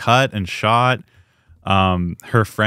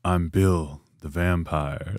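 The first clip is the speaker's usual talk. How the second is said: mock brooding Southern vampire, very low and soft; 6 semitones lower, 7 dB quieter, slower than usual. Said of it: "sounds like a bad [name]".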